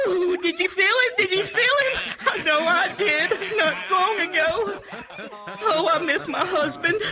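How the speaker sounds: high, loud camp squealing; hammed-up delighted shrieks; giddy tour guide. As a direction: imitate a flamboyant guide giddy with excitement.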